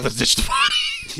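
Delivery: very high, squealed mock quote; hammed-up high-pitched yelp; mock stepdad outburst. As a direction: squeal it as an absurd suggestion. Mock quote of stepdad's absurd suggestion.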